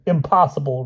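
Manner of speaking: high-pitched outraged outburst; hammed-up indignant protest; raving Hyde. A narrator's reading. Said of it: "raved Hyde".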